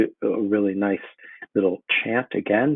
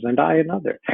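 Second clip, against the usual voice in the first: witch voice; 8 semitones higher than usual. Acted. Teacher voicing third witch.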